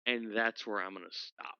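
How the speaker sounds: usual speaking voice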